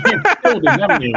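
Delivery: laughing. Real, not acted. Laughing at the dropped connection.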